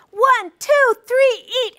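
shouted high start call; high loud countdown. Shown as a demonstration. Judge starts the pie contest with a high shout. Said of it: "We did the high-low".

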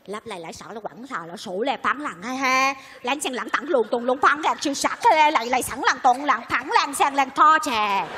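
rattled-off Chinese-sounding gibberish; mock Chinese. Woman gossiping so others won't understand, mock Chinese gibberish. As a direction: babble in pretend Chinese, drawing out the end. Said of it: "nói tiếng Tàu ai mà hiểu".

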